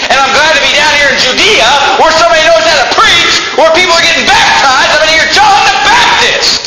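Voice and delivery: preacher voicing Jesus as a fired-up churchgoer, shouting. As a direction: belt it out like a revival preacher. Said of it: "He said".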